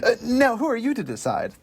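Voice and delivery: high-pitched indignant challenge; evil passenger. Acted. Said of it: "that guy is so evil".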